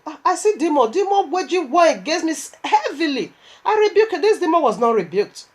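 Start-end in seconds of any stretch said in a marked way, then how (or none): 3.7-4.2 laughing rebuke. Retold or imitated.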